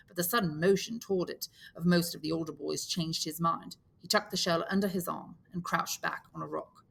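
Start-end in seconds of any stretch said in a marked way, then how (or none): none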